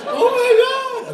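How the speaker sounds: high, loud mock wail. Wife weeping at Christmas dinner, hammed-up wail. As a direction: cry out in slow mock anguish.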